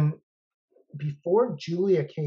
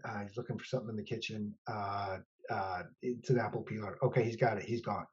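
low quick murmur; hushed; 5 semitones lower, 8 dB quieter than usual. Retold or imitated. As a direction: keep it down, he's in the room. Hushed report while Kramer present.

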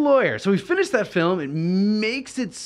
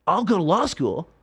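excited pitch; 13 semitones higher than usual. Retold or imitated. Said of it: "I was like, Rob, my director".

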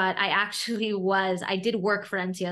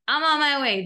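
higher, louder, mock-eager burst; telling the dean she's coming, in an eager higher yell; eager exclamation; 8 semitones higher, 7 dB louder than usual. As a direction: jump at the offer. Rushing to accept money award.

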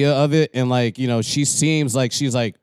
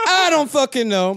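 normal talking voice

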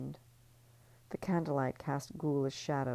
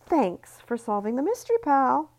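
high, loud sweet thanks with a bite; sugary menace; 11 semitones higher, 8 dB louder than usual. Acted. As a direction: thank her sweetly while squeezing hard. Ellie's deadly squeeze.

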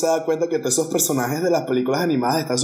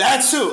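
a sudden cartoon sneeze; mock sneeze; 8 semitones higher than usual. God sneezes, wiping out galaxy.

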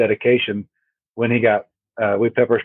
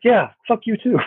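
mock retort; 10 semitones higher than usual. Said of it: "I'm like".

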